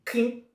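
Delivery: short high-pitched "clink"; imitated metal clink. Shown as a demonstration. Game master voices a snapping lockpick, high clink. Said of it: "as you hear".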